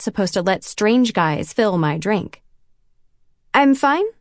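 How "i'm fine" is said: "I'm fine" lifted up at the end like a question; nervous "I'm fine" that rises into a question; unsure rising reply. Acted. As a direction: say "I'm fine" as if asking, voice climbing. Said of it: "It comes out sounding like a question".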